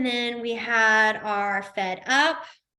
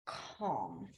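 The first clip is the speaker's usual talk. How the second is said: much lower, soft and calm "calm"; 15 dB quieter than usual. Shown as a demonstration. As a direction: settle the word down gently. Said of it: "calm is going to be".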